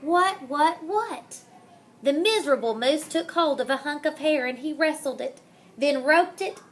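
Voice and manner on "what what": usual speaking voice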